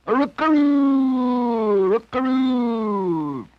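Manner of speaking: high, slow, drawn-out rooster crow; lonesome crowing. A narrator's reading. Old molting rooster crowing for midnight, high and pitiful. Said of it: "crowing so lonesome and pitiful".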